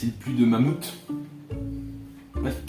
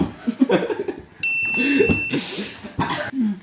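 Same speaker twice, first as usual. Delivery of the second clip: high squealing laugh; burst of high laughter. Real, not acted. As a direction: break into a shrill giggle. Laughing before his joke. (éclat de rire aigu)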